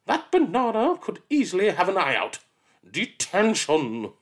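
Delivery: deadly serious about a banana; absurd scolding. Acted. Miss Seeth scolds the banana child, stern.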